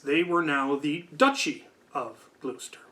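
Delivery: ordinary talk, nothing marked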